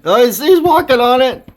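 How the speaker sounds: high, loud delighted exclaiming; excited. Real, not acted. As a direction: burst out in amazed glee. Bird standing on frozen bowl.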